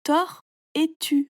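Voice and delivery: "tort et tu" slowly, each part set apart; separated parts. Shown as a demonstration. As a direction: isolate "tort" and "tu" clearly. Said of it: "fabriqué avec deux petits mots".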